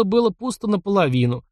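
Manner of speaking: usual speaking voice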